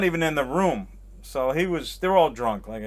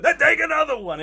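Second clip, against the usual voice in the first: high, loud insistent exclamation; hammed-up, loud and pushy generosity; mock generous owner; 13 semitones higher, 7 dB louder than usual. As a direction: imitate a big spender pressing cash on someone. Acting out owner pushing more money.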